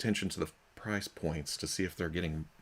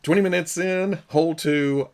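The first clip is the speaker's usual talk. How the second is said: excited; 8 semitones higher, 9 dB louder than usual. Real, not acted. Boasting about his golf pace.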